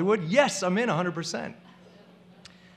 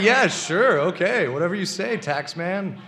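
sarcastic agreement; 4 semitones higher, 8 dB louder than usual. Retold or imitated. Mocking the tax interview.

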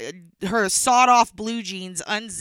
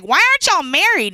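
put-on child voice; 8 semitones higher, 7 dB louder than usual. Child asks nosy question.

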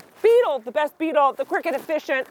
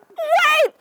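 high shouted wait; 13 semitones higher, 3 dB louder than usual. Acted. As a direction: cry out to stop everything. Characters all cry wait.

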